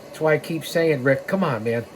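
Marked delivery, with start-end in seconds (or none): none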